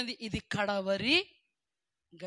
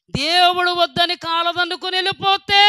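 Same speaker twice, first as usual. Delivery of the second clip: much louder and higher, thundering warning; loud, higher sermon warning; fiery preaching; 8 semitones higher, 14 dB louder than usual. Acted. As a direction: hammer the warning home from the pulpit. Preaching at full force.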